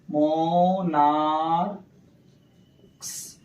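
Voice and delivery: Monarchs stretched very slowly, syllable by syllable; drawn-out sounding out. Shown as a demonstration. Teacher sounding out the plural for students, monarchs greatly stretched. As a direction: draw out monarchs as "moo-naar-ks".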